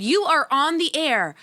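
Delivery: normal talking voice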